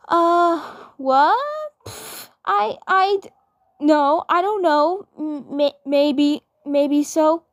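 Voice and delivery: put-on high, stammering girl voice caught out; a flustered twin sister protesting too much; flustered Mabel.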